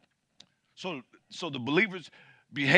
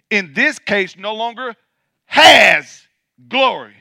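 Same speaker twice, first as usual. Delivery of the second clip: loud, high, ringing preacher's cry, slowed on key words; full-force preaching; 8 semitones higher, 17 dB louder than usual. Acted. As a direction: preach it out to the whole church, building. Preacher reading scripture at full force.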